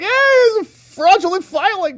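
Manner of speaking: high, loud, gleeful squeal; excited squeal. Retold or imitated. Thinking he caught a fake filing, a high gleeful squeal. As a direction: crow with mock delight.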